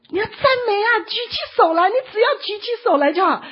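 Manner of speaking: high, loud urgent shout. Retold or imitated. Servant shouting again at the man who cannot hear. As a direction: shout it down, begging. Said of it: "他就一直又在跟他喊呐".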